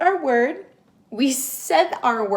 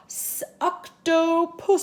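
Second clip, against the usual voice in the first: slow sounding out; 5 semitones higher, slower than usual. Shown as a demonstration. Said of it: "sound it out".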